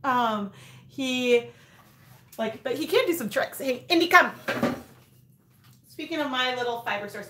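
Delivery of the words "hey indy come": called out, louder; calling the dog. Real, not acted. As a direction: call the dog to come.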